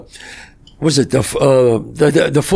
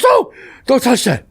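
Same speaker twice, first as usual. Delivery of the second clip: grandmother warning; 9 semitones higher, slower than usual. Retold or imitated. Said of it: "She goes".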